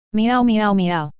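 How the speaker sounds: slow, drawn-out meowing like an upset cat; mock cat yowls; cat meows. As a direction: meow slowly like a cat in protest. Cat cries after legs grabbed.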